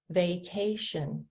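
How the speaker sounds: "Vacation" slowly split as va-ca-tion; counting syllables. Shown as a demonstration. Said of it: "that word has three syllables".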